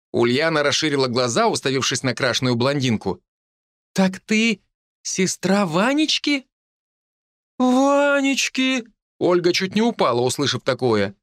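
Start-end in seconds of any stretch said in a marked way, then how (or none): 3.9-6.5 slow, amazed, drawn-out question; surprised. A narrator's reading. 7.6-8.9 high, very slow outraged echo; shocked. A narrator's reading. Shocked repeat of the name.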